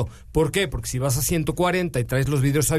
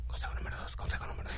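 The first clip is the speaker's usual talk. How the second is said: whisper; 15 dB quieter, much faster than usual. Real, not acted. Whispered cue to the co-host.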